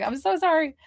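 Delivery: a bright high apology. Retold or imitated. To the viewer, a high sing-song apology. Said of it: "And I'm like".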